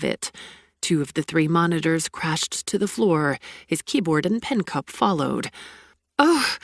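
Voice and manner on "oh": high, loud gasping oh; cry of welcome. Acted. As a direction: let out a sudden thrilled cry. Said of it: "I cried".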